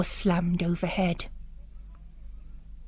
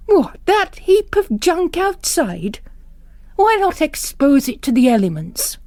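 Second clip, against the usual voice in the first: scornful bishop; 7 semitones higher, 3 dB louder than usual. Acted. Bishop mocking Father Angwin's car, scornful and loud. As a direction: scoff at his old heap.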